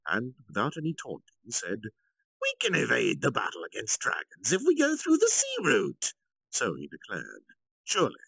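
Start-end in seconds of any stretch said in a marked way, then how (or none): 2.4-6.1 higher and louder, light and untroubled; Yuki, smiling. A narrator's reading. Yuki calmly counters the council's doubt, higher, smiling. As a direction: explain it with an easy smile. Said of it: "Yuki denied it with a smile".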